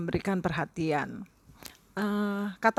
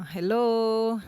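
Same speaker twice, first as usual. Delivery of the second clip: calling out hello; 5 semitones higher, 5 dB louder than usual. Shown as a demonstration. Ignored, calling for attention.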